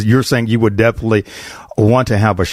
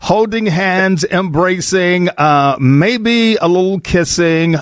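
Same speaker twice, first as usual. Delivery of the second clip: teasing list; 6 semitones higher, slower than usual. Real, not acted. Listing dating behaviours teasingly.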